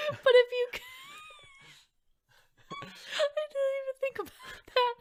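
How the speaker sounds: laughing outburst; laughing shout. Real, not acted. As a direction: shriek through a laugh. Bursts out laughing mid-question.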